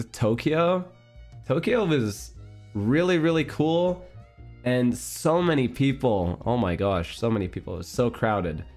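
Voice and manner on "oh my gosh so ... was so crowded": normal talking voice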